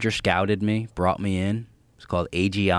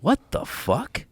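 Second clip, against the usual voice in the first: stunned disbelief; 9 semitones higher, slower than usual. Retold or imitated. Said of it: "just going like".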